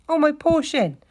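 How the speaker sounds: loud, very high wail; pained cry. A narrator's reading. Mole fallen in the snow, wailing in pain. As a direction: wail over a hurt shin. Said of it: "he cried".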